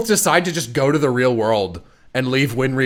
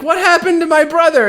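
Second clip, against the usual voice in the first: much higher, fretful cry; mock worried Al; 12 semitones higher, 3 dB louder than usual. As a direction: ask in a squeaky panic. Voicing Al's worried question.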